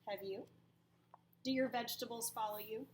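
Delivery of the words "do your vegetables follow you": ordinary talk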